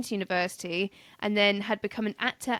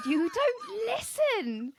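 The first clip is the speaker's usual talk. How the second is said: high, slow whiny mock voice; 12 semitones higher, much slower than usual. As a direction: drag it out in a mocking whine.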